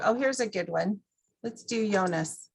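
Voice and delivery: ordinary delivery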